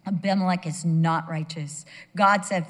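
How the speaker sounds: ordinary talk, nothing marked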